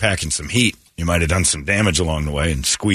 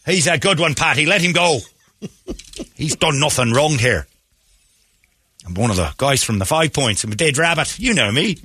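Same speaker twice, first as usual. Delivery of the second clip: put-on Irish accent; 7 semitones higher than usual. Flattering the arresting police, hammed-up Irish accent. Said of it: "go into your Irish accent".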